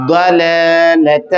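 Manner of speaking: ضلالت slow, heavy daad, doubled lam; heavy stressed daad. Shown as a demonstration. Teacher drills ضلالت with shadda for students. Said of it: "پورا پھر پڑھیں گے".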